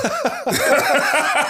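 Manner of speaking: a big high burst of laughter; loud high laugh. Real, not acted. Father laughing hard about raising kids, loud high laugh. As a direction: crack up loudly, high in the voice.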